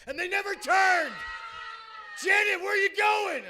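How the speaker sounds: high yelled mock outrage. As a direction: yell at the planes up in the sky. Yelling at the planes.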